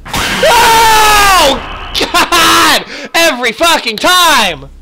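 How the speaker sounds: high, loud startled shout; jump-scare scream. Real, not acted. Gamer jump-scared by Sophie, startled scream.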